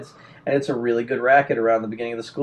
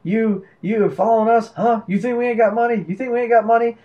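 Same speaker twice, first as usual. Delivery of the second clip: retold yelling; 6 semitones higher than usual. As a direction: imitate an angry accuser at half volume. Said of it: "yelling at me".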